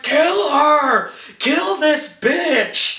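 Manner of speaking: high, strained mock yell; drawn-out imitation of a desperate order; hammed-up battle cry. Fighter strangling Kelly, yelling to the others. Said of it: "And then he's like".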